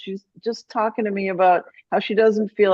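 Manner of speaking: normal talking voice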